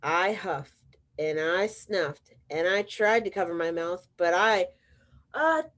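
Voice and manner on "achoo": a sudden sharp achoo sneeze. Acted. Wolf sneezing at the pig's door, sudden achoo. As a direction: burst out in a big sneeze.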